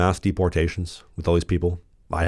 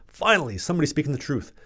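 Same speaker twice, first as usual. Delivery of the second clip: higher, softer mock commenter voice; imitating a commenter; 7 semitones higher, 3 dB quieter than usual. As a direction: lightly act out a grateful fan comment. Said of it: "You leave a comment".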